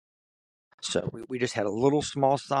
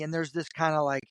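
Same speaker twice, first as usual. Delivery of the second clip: usual speaking voice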